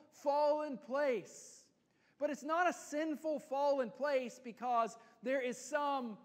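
full-force preaching. Acted. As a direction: preach it out, rising to the crowd. Preaching climax, loud and high.

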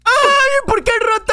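high shrieking panic; shrieking at a jump scare; frightened scream. Real, not acted. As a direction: screech in terror. Jump scare in horror game.

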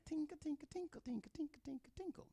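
high, thin tinkle tinkle; mock tinkling bell voice; tinkling sound. Shown as a demonstration. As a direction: ring it out light and high like a little bell. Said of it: "You sound".

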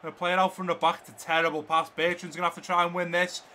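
loud, projected football commentary; live play-by-play. Real, not acted. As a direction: call the match as it happens, peaking at the goal. Live match commentary over gameplay.

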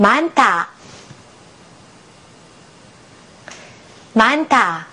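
"マンタ" as one word with aspirated t; "マンタ" said whole, air released on the タ; model aspirated pronunciation. Shown as a demonstration. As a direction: model "マンタ" for learners to repeat, puff on タ. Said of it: "発音してみましょう".

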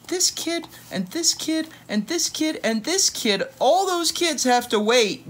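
put-on high, bright pointing at each kid; squeaky high counting. Reading to a child, counting kids in high voice.

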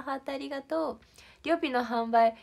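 normal talking voice

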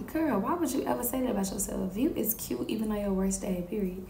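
quick, soft acted aside; hushed reproach. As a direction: say it under your voice, quickly, like a gentle telling-off. Acted reply to her self-talk.

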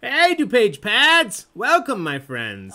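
big, slow, loud welcome shout; loud greeting. Real, not acted. Host loudly welcomes a team joining the trivia stream. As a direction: call out a hearty welcome to the team.

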